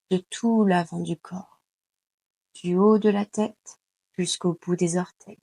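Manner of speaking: normal talking voice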